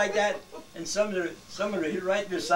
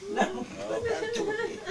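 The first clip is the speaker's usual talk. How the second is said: laughing; 4 dB quieter than usual. Real, not acted. Laughs while telling the trick.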